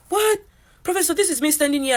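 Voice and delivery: a sharp, high what, then pleading; can't believe she's being ignored; shocked disbelief. Retold or imitated. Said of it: "I'm like".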